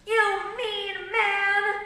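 high, loud, drawn-out scolding; child's protest. A narrator's reading. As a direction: stretch it out like an indignant child. Child's insult, politely corrected.

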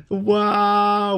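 real thrilled surprise; awed gasp. Real, not acted. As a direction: gasp and cry out in wonder. First sight of the sharks.

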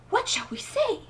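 plain reading voice

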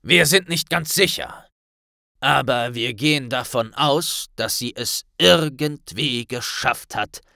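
a lightly voiced solemn minister; grave Kingsley. A narrator's reading. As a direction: minister briefing Harry and Hermine, grave. Kingsley explains.

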